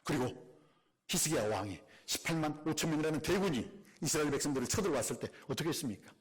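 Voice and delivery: usual speaking voice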